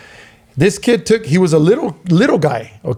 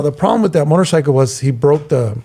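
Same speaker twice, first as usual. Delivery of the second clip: normal talking voice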